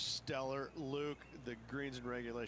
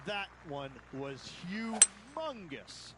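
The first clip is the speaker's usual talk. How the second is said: excited; 6 semitones higher, much slower than usual. Acted. Big drive praised.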